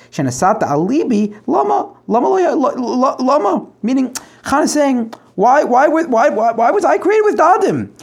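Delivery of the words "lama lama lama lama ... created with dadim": pleading cry. Acted. Teacher voicing Chana's anguished plea.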